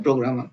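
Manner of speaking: प्रोग्रामा read quickly as one word; fluent reading. Shown as a demonstration. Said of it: "इसको ऐसे पढ़ते हैं". (प्रोग्रामा एक साँस में जल्दी)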